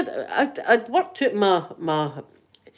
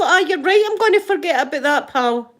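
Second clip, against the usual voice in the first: sarcastic retort; 7 semitones higher, 4 dB louder than usual. Retold or imitated. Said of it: "I thought".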